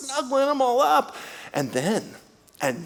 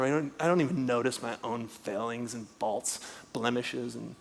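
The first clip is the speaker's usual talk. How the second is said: fading to a whisper; 10 semitones lower, 14 dB quieter than usual. Retold or imitated. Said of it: "voicing the blind brother".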